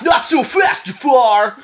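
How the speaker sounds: high, loud surfer dude hero; Aladdin surfer voice. Acted. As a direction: call it out like a cartoon surfer boy. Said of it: "when you make him sound like that".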